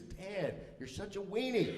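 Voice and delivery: high, mocking put-down; exasperated son. Teenage son to his cowering dad, exasperated put-down. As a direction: imitate a disgusted teenager.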